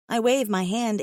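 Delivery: usual speaking voice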